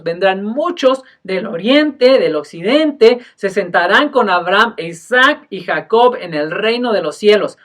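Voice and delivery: loud, solemn Bible reading; scripture reading. Acted. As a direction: read the passage with weight. Reads scripture aloud in sermon. (lectura bíblica solemne)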